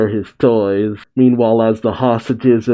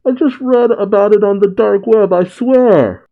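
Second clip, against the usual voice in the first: high-pitched protest of innocence; acted squeal of a cornered crook; panicked pleading; 10 semitones higher, 3 dB louder than usual. A narrator's reading. Pinned crook pleads his innocence.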